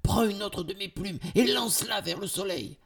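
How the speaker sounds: high, urgent instruction; urgent command. A narrator's reading. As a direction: call out the order mid-chase. Urgent order during the chase.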